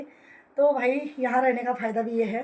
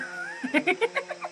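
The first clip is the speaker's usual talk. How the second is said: uncontrollable high laughter; can't stop laughing, high pitched; 11 semitones higher, 3 dB quieter than usual. Real, not acted. Wife to viewers about husband's burns, helpless high laughter. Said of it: "हंसी ही नहीं रुक रही है".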